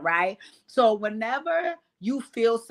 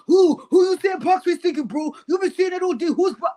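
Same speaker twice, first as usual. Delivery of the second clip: high, loud, indignant imitation; hammed-up squawk of someone butting in; mock Biggie outburst; 9 semitones higher, 7 dB louder than usual. Host mimicking Biggie butting into the talk, indignant. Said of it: "So you felt".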